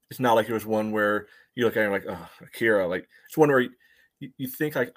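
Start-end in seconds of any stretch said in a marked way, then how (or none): none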